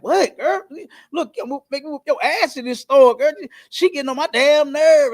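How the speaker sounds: high, loud mock scolding of a daughter; mama snapping. Imitating a mother scolding her daughter in the store, high and loud. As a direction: snap at the kid like the angry mama. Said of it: "the mama snapped".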